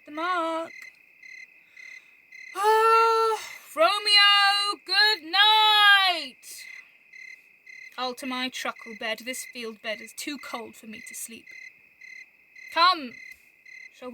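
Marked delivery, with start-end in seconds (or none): 2.5-6.3 higher, louder mocking goodnight call; jeering goodnight to a hiding friend; teasing loud farewell. Teasing farewell shouted into the dark. 8.0-11.5 fast throwaway jest. Acted. Quick throwaway jest after the shout.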